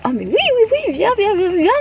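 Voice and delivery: high-pitched, eager grandma on the phone; excited mock-grandma welcome; imitating grandmother. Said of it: "Ma grand-mère, je l'ai au téléphone".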